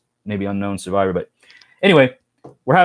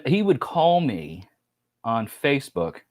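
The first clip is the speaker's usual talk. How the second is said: ordinary talk, nothing marked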